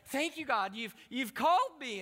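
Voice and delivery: high, loud, elated thanks; rejoicing. Retold or imitated. Twelve-year-old thanks God for a sign, rejoicing. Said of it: "as soon as I started rejoicing".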